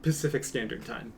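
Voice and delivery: usual speaking voice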